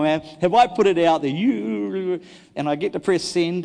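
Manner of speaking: ordinary delivery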